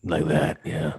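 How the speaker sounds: low, lightly voiced creep; creepy voice. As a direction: keep up the creepy act, low and soft. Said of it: "What, talking creepy?".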